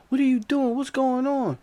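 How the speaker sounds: high, loud what's going on; startled shout; alarmed shout. Retold or imitated. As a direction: cousins shocked at the scene. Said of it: "They're like".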